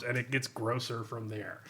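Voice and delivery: usual speaking voice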